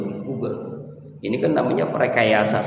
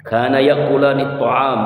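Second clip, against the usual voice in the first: raised chanted Arabic; Quranic recitation; 3 semitones higher, 4 dB louder than usual. Acted. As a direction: chant the verse out. Quran recitation within sermon. (melantunkan ayat)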